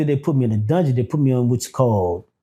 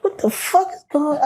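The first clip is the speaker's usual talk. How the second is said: retold alarm; 13 semitones higher than usual.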